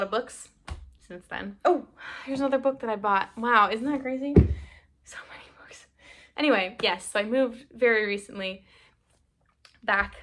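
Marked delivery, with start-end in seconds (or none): 5.0-5.9 breathy fake-crying whisper; hammed-up tearful whisper; mock sob whisper. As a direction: whisper it like you're overwhelmed to tears. Mock overwhelmed at book pile.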